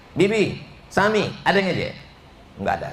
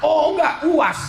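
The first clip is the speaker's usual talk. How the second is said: playful correction; 12 semitones higher than usual. Real, not acted. Bercanda meralat nama.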